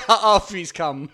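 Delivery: loud, slow, through laughter; real laughing correction. Real, not acted. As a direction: blurt it out while cracking up. Laughing while correcting his co-host.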